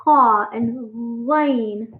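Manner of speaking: "ha and line" drawn out very slowly; teacher spelling out the two sounds; slow letter demo. Shown as a demonstration. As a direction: stretch each letter sound. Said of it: "So it's like".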